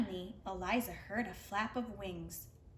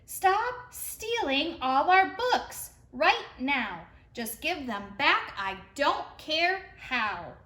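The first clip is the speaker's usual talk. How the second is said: loud, slow, higher shout; 4 semitones higher, 8 dB louder, slower than usual. Acted. Eliza shouting out the window at the thief, loud and slow. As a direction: bellow the demand out the window. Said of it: "shouted to the thing outside".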